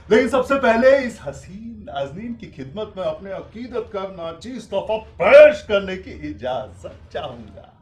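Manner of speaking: loud, high theatrical flourish; booming, ornate tribute to a beauty; grandiloquent flattery. Acted. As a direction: declaim it like a showman courting a crowd. Showman's flowery tribute to a lady.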